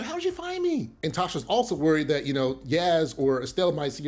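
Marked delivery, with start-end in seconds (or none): 0.0-0.9 high-pitched imitation of a frightened woman; hammed-up fright, pitched way up; mock scared. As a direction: squeal it like you're startled at being found. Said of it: "and Tasha's scared".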